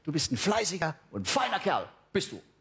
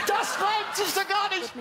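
outraged shout; slower than usual. Acted. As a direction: yell the complaint up high and drawn out. Repeated complaint, much higher.

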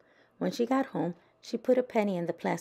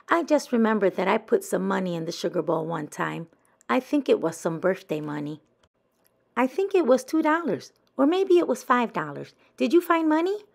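put-on louder, climbing higher child voice; pushy Thelma; 5 semitones higher, 7 dB louder than usual. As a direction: press harder for the money. Thelma pressing about money.

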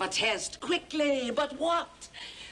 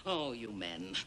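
much lower and softer, theatrical sigh; put-upon sigh at men; mock lament; 12 semitones lower, 7 dB quieter than usual. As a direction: sigh it like a weary diva.

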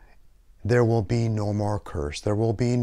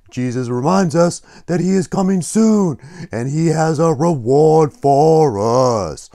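loud, theatrical puppet preacher; Blub Blub; 5 semitones higher, 8 dB louder than usual. Pretend. Puppet promises viewers a reward from Jesus, loud emphatic preaching. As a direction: punch it out and stretch the last word.

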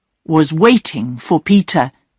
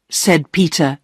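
usual speaking voice

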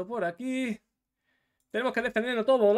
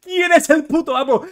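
long, loud, high triumphant yell; victory scream; 9 semitones higher, 18 dB louder, much slower than usual. Real, not acted. Streamer screaming at a narrow win, victory yell.

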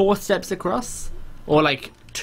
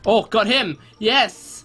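triumphant; 6 semitones higher, slower than usual. Real, not acted. Takes out attacker in game.

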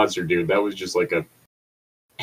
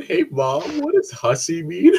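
put-on high childlike voice, innocent question; kid asking mom; slower than usual. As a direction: play a curious little kid asking Mom. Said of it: "I'm just imagining some kid".

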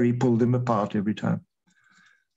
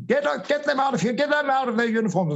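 much higher, imitating an enraged prosecutor shouting; ranting shout; 12 semitones higher than usual. The prosecutor shouting at uniformed officers to leave, mock furious rant. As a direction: throw them out, half-mocking his rage. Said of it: "he started ranting and shouting".

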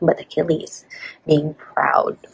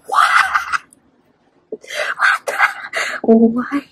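loud, high disbelieving cries; shocked outburst; 8 semitones higher, 5 dB louder, much slower than usual. Real, not acted. Reacting to the book's turn, loud shocked cry. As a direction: blurt it out, stunned by the twist.

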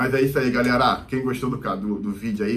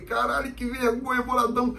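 embarrassed outburst; 11 semitones higher than usual. Retold or imitated. Narrator's embarrassed inner reaction.

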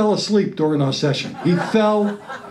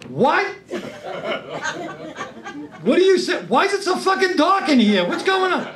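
startled outburst; 8 semitones higher than usual. Retold or imitated. Startled reply to whispering therapist.

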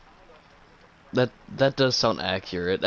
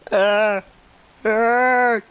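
alarmed; 11 semitones higher, 6 dB louder than usual. Real, not acted. Plane shot down mid-game.

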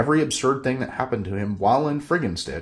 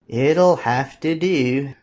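slow, louder, dismissive tiny king; the king's voice; 5 semitones higher, 5 dB louder, much slower than usual. Acted. The king inspecting Bob, slow grudging royal verdict.

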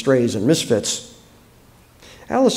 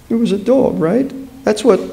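higher, louder, upset voice; upset, breaking off before the sentence is done; struggling for composure; 9 semitones higher, 4 dB louder than usual. A narrator's reading. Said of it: "she had to break off".

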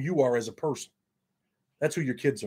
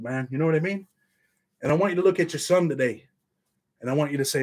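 ordinary talk

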